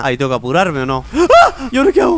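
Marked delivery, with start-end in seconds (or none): 1.1-2.2 sudden high frightened shriek; startled, scream in real fright; high panicked yelp then frantic question. Real, not acted. Chased by chica in game.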